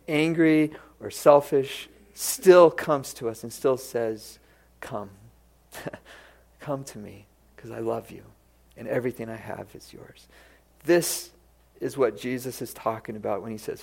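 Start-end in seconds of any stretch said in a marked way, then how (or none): 4.8-10.3 much softer, gentle and inviting; tender invitation. Acted. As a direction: coax them home gently. God the father invites the angry.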